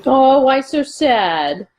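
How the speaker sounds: teasingly sorry, stretched out; mock sympathy. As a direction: coo it slowly, feigning pity. Teasing a defeated character.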